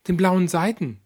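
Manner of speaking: a touch of a casual mother; mother's chatty voice. Acted.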